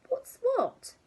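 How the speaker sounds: very high, panicked question; squeaky alarm. A narrator's reading. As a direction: yelp it in alarm. Husband alarmed.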